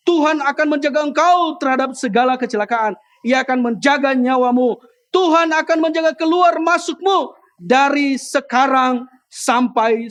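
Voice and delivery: loud, ringing declamation, slowing to the end; forceful scripture reading. Acted. Preacher reading the psalm to the congregation, forceful scripture reading. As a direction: proclaim the verse to a whole hall.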